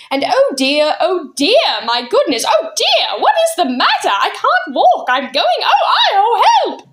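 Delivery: high, rising cries of alarm; flustered panic. Acted. Miss Persimmon floating off the ground, panicking in high cries. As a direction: panic as your feet leave the floor.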